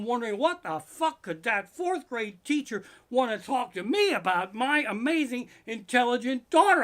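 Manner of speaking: high, loud, frantic rant building to shrieks; panicked ranting. Retold or imitated. Dad imagining the worst about his daughter, frantic high rant. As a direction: spiral into a shrill weekend-long panic. Parent panicking over teacher's call.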